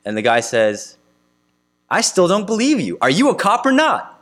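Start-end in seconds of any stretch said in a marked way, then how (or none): 1.9-4.2 higher, exasperated disbelief; exasperated skeptic. Retold or imitated. Preacher voicing a stubborn man confronting a police officer, exasperated. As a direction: demand it like a man refusing the obvious.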